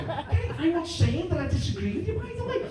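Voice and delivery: high, excitable Scouse bickering; a squeaky Carragher cutting in on his mate; Carragher impression. Carragher butting in on Gary, squeaky and fast. Carragher voiced arguing with Neville.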